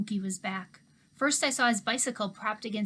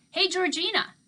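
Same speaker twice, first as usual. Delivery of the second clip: louder, higher call across the yard; 10 semitones higher, 7 dB louder than usual. A narrator's reading. Old handyman calling after the girl as she walks away, raised voice. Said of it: "he called out".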